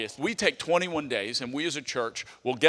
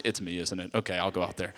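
sheepish admission; 9 semitones lower, 7 dB quieter than usual. Retold or imitated. Admitting fault alone to God.

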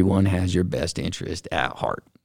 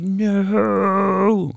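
a sharp high-pitched no of protest; high disbelieving no; 11 semitones higher than usual. Retold or imitated. Retelling being turned away, a high disbelieving no. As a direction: refuse it, voice jumping up. Told they can't invest.

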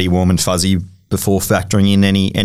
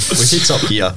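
ordinary talk, nothing marked

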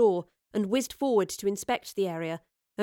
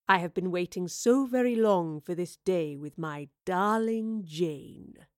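lingering, possessive villain voice; gloat, lingering over the beloved's name; 2 semitones lower, slower than usual. A narrator's reading. Villain gloating over his bride.

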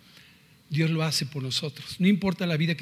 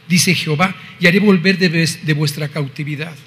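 solemn scripture reading; 6 dB louder than usual. Acted. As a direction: proclaim the verses loudly. Reads scripture aloud. (lectura solemne de la Biblia)

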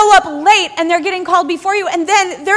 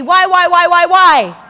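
louder, insistent why, why, why; pleading why; 5 dB louder than usual. Acted. Believer crying out to God, pleading loudly. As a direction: press each why harder and harder. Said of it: "we're looking out there and we're going".

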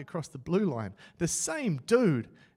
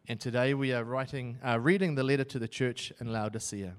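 ordinary talk, nothing marked

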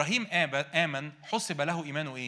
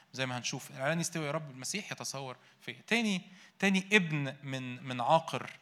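normal talking voice

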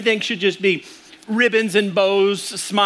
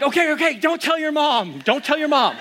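higher-pitched, pleading hush; mock panicked dad; 8 semitones higher than usual. Panicked dad hushing frightened daughter.

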